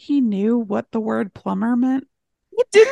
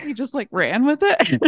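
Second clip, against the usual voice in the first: usual speaking voice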